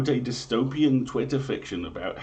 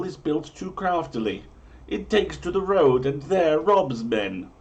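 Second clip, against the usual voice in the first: grand, raised olde-English storyteller read; dramatic prophecy reading; 6 semitones higher, 3 dB louder than usual. Acted. Host reading medieval omens to listeners, grand storyteller voice. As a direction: proclaim each omen like a town crier.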